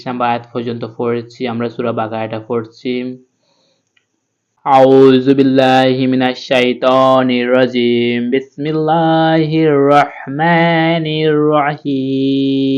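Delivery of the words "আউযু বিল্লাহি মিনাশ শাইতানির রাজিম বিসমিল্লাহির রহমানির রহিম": slow melodic tilawat, drawn out; Quran recitation. Acted. Teacher recites the opening formula slowly, melodic tilawat.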